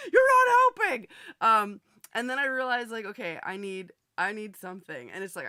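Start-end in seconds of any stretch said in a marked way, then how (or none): 0.1-1.1 loud, high shout through a laugh; mock angry yell. Host laughingly replaying yelling at Bull. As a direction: yell it, still laughing at yourself. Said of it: "I was just like".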